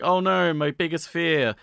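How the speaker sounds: playful fake dread; mock terror. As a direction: ham up the panic. Mock panic at boulder.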